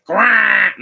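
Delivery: very loud, high penguin quack; cartoonish bird squawk, high and blaring. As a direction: let out a sudden squawking quack. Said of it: "Colin Farrell doesn't do that in the show".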